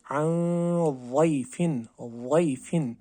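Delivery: वैफिन slowly with a thick heavy "zaad". Shown as a demonstration. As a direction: recite वैफिन slow and heavy. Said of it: "हमने पढ़ना है वैफिन".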